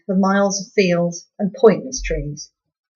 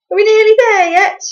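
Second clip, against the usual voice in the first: put-on much higher, louder child's whine; whiny little girl; 9 dB louder than usual. A narrator's reading. Little sister to parents in the car, a high loud whine. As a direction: nag like a bored small child.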